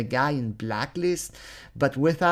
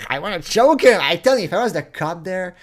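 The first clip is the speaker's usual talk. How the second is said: loud, higher burst of rage; exasperated outburst; 6 semitones higher, 7 dB louder than usual. Real, not acted. Ranter venting about wanting to choke the suspect, exasperated outburst. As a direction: erupt as if at breaking point.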